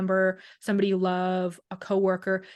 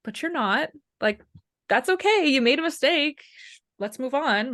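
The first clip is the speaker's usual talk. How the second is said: higher, reassuring reply; upbeat coaxing reassurance; 5 semitones higher than usual. Retold or imitated. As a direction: brightly reassure them it's fine. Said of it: "And I'm like".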